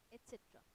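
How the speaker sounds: "et cetera" softly, as et-set-ra; correct pronunciation. Shown as a demonstration. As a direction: say "et cetera" quietly with no k sound. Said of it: "Something very new". ("et cetera" धीमे से, एट-सेट्रा)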